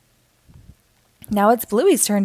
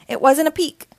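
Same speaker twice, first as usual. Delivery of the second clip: put-on child character voice; 7 semitones higher, 4 dB louder than usual. Bluey denying peeking to Dad.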